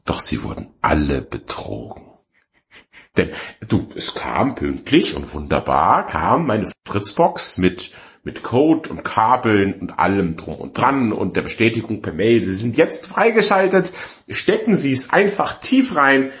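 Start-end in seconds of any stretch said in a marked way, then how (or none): 0.0-2.0 low, ominous narrator quote; mock-epic doom, pitched way down; Lord of the Rings prologue. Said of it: "Wie heißt's im Herr der Ringe am Anfang?". 12.4-16.3 high, over-enthusiastic mail readout; imitated cheery welcome message; mock advertising copy. Confirmation mail read out mockingly.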